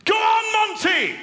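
very high, loud posh English yell; poshest shout. Retold or imitated. Mate cheering on a pub fight, shouted in ultra-posh English. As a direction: holler it in an exaggerated posh English voice. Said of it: "shouted out in the poshest voice".

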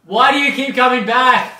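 loud, high mock-desperate yell; mock exasperated shout. Co-host asking the audience why they keep returning, mock shout. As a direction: yell it high, as if baffled by them.

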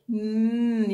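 "Mm" hummed as a long nasal mm; the n sound. Shown as a demonstration. Teacher modelling the letter N sound "Mm" for the class. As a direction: hum "Mm" for the n sound, lips closed. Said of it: "Can you do it with me?".

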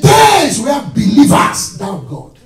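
sudden full-voiced high preaching cry; preaching shout. Real, not acted. Preacher shouting his strong message to the church. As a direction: hit the congregation with it.

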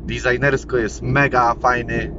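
ordinary talk, nothing marked

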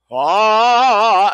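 sharp gasping intake of air then a loud open ah; Mueller maneuver gasp. Shown as a demonstration. Voice teacher demonstrating the Mueller maneuver breath for a singer, a gasp then a loud ah. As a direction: suck air through a half-closed glottis, then release. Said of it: "you suck in the air through a half-closed glottis and you go".

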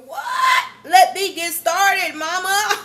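loud, high exclaiming shout; incredulous outburst. Retold or imitated. Daughter protesting her mom's coffee day message, shouting. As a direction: shout it in disbelief at mama. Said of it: "I said".

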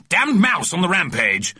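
put-on high-pitched, indignant old gent; blustering old man. A narrator's reading. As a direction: report it as a blustering old gentleman. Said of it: "said the moustache".

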